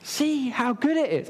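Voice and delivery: eager urging. Acted. Preacher showing someone a beautiful view, eagerly urging. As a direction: urge someone to share the view. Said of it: "the desire in me is to say".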